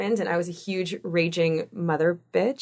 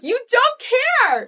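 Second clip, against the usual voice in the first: much higher exasperated cry. Retold or imitated. Mom to defiant son, high exasperated. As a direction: throw up your hands in disbelief. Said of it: "I'm like".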